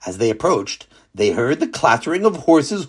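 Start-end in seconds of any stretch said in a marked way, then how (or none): none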